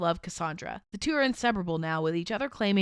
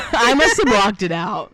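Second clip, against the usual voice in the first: laughing; 8 dB louder than usual. Real, not acted. Laughing after Timmy.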